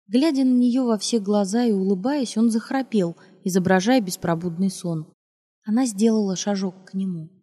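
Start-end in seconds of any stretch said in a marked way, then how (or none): none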